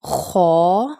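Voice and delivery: خو full and rounded, drawn out; bold, long خو; thick, heavy letter. Shown as a demonstration. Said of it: "خو کو تھوڑا موٹا پڑھا جاتا ہے".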